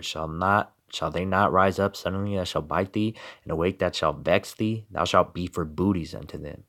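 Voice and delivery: subdued solemn reading; quiet scripture reading. Acted. Rereads scripture softer.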